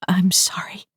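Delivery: whispered, choked apology. Acted. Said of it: "Silva choked out".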